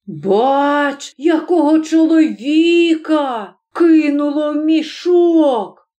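high, slow, drawn-out alarm; frightened. A narrator's reading. Scared weaver seeing a man in the sack, frightened. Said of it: "сказав переляканий ткач".

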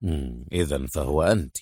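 low, soft grumble; deep, subdued male character voice; muttering through gritted teeth. A narrator's reading. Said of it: "وتمتم وهو يضغط على أسنانه".

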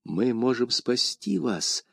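тихо, вполголоса; quick whisper. A narrator's reading. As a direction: tell him secretly so the guards don't hear. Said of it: "быстро шепнул ему Д'Артаньян".

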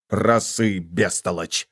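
low, softened irritated correction; раздражённое шипение. A narrator's reading. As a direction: correct the dunce under your breath. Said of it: "раздражённо зашипел Флини". (раздражённо прошипеть)

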